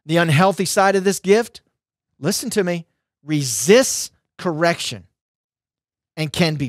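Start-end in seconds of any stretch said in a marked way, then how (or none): none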